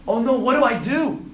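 high, loud mock panic; hammed-up fretful outburst; scared and worrying. Believer about to share their faith, scared and worrying. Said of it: "feel scared and you start worrying".

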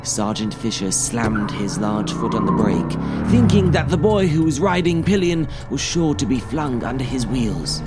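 usual speaking voice

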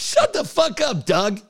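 high-pitched mock-indignant retort; retold telling-off. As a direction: tell Doug off, pitch jumping up. Retelling his telling-off of Doug.

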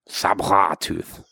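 put-on low French-accented "Sabre tooth"; "Sabre tooth" made mock-French, pitched low; French pronunciation. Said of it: "That is what we call him".

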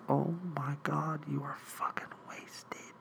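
shocked whisper to himself; whispered aside. Retold or imitated. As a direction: mutter it so she can't hear. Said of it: "And I'm like".

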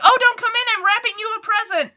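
high, rushed sing-song cover-up; a high, hurried fib to hide the surprise; squeaky Stephanie quote. Retold or imitated. Stephanie calling through the shut door, high and rushed. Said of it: "and Stephanie's like".

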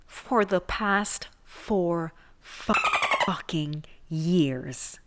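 drawn out very slowly, heavy with disbelief; slow, exasperated drawl on fucking years. Acted. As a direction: let each word sag in disbelief. Dragging out the swear in disbelief.

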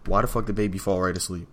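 normal talking voice

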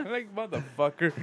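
high laugh breaking through the words; can't stop laughing at the memory; laughing hard. Real, not acted. Laughing to his cohost at the fart story.

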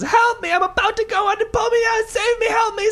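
very high, loud screaming for rescue; desperate shout. Acted. Drowning man shouts at the third passerby, desperate. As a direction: shriek as the mud reaches your chin. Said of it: "And he says".

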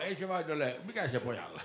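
lightly voiced dry old man; a hint of a deadpan storyteller. Storyteller calmly asks about the laughing boy. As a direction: calmly wonder what's wrong with the boy. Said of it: "Pemma vaan".

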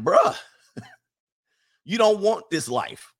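slow, laughing bruh. Real, not acted. To the sisters at the controller, laughing. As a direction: laugh through a mock warning.

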